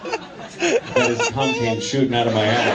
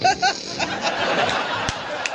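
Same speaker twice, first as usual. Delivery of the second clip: big high laugh. Real, not acted.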